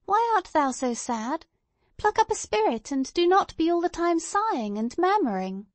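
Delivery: put-on high, swooping little-girl voice; lively, high-pitched child scolding; spirited child. A narrator's reading. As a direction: chide like an earnest small child. Narrator voices child Pearl.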